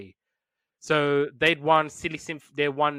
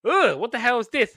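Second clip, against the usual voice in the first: higher, louder mock disgust; disgusted mother hen; 8 semitones higher, 6 dB louder than usual. Podcaster voices mother hen seeing the odd chick, disgusted. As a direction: recoil in exaggerated revulsion. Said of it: "and she's looking at, like".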